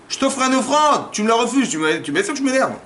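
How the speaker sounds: high, loud offended complaint; indignant protest. Acted. Offended offerer complains to God, indignant protest.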